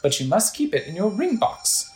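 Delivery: animated, mystical shopkeeper voice; Vasu. Acted. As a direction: welcome and explain like a showy merchant. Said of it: "a man with a turban who says".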